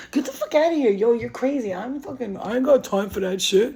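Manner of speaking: high, loud imitation of indignant denial; lying sister's denial. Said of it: "with her Gemini lying ass".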